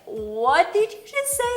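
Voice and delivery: high, loud, incredulous retort; mock disbelief. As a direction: act out a stunned comeback. Acts out her shocked reply.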